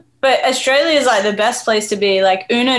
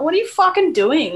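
mock disbelief; 7 semitones higher than usual. Mock reaction to friend's bad accent.

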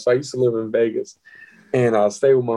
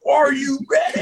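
high, shouted game-day call; playful mock shout; mock hype shout; 11 semitones higher than usual. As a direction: pump her up like a sports announcer. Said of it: "I'll be like".

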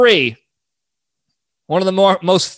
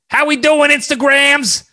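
Big Time Tommy; 6 semitones higher than usual. Retold or imitated. Podcaster imitates Tommy greeting his followers in a high, brash voice. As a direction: ham up the cigar-puffing influencer hello. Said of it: "And that's how he sounds".

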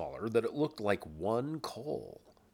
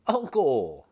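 loud, higher cry of protest; pleading; 5 semitones higher, 8 dB louder than usual. A narrator's reading. Nephew protests at his uncle's rant, pleading. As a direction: appeal to him, raised and falling. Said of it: "pleaded the nephew".

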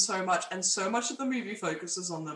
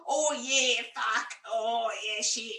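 put-on bogan Australian accent; 4 semitones higher, slower than usual. Imitating bogans from her town, Australian accent. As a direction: drawl it like a country-town Aussie bloke. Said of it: "you just hear people like".

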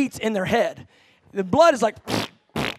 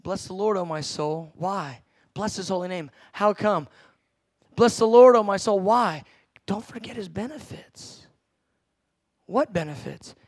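preacher's call and response; 3 semitones lower than usual. Acted. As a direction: lead the congregation through the psalm.

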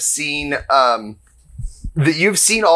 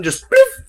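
magic pop sound; 4 dB louder than usual. Acted. Machine vanishes.

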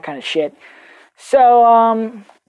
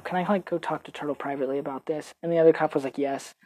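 hushed; 4 semitones lower, 12 dB quieter, faster than usual. Retold or imitated. Quiet request to cop.

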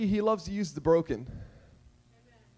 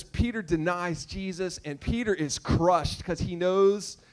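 usual speaking voice

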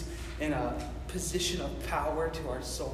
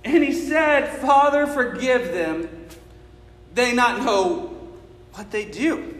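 high, loud, slow shouted preaching; full-force preaching; 13 semitones higher, 16 dB louder than usual. Acted. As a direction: preach it with everything, crying out.

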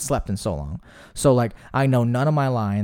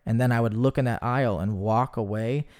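ordinary talk, nothing marked

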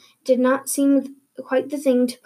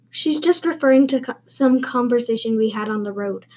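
quick hushed whisper; hasty whisper. Acted. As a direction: brush it off under your breath. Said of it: "said Matthew hastily".

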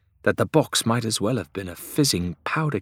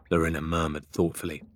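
ordinary delivery, nothing marked

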